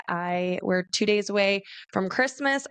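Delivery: ordinary talk, nothing marked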